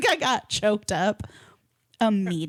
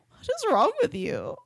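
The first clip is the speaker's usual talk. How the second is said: high, incredulous what is wrong with you; mock horror at her husband; appalled wife; 10 semitones higher than usual. Narrator voicing the nurse wife scolding her husband. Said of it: "even she was like".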